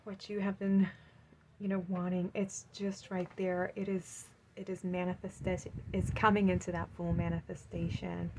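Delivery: ordinary delivery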